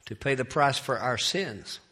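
usual speaking voice

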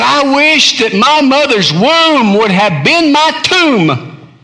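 high, loud and slow lament; anguished cry. Acted. Preacher voicing Jeremiah's wish to die, high anguished cry. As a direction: cry it out to heaven.

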